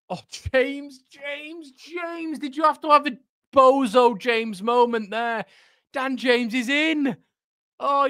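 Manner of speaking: very high, pained complaint; exasperated wail. Real, not acted. Fan despairing at a winger's bozo moment, exasperated wail. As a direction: wail it in disbelief, voice pushed right up. Exasperated at James wasting a chance.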